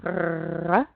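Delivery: "Rrrr" growled from the back of the throat; French growly R. Shown as a demonstration. Teacher models R for practice at home, throaty growl. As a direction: rattle the uvula on "Rrrr". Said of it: "Practice your R sound".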